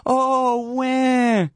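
high mocking wah; taunting crybaby wail; mock crying wah. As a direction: fake baby cry. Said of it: "say to them".

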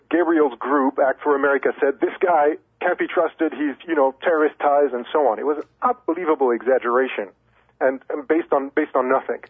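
ordinary talk

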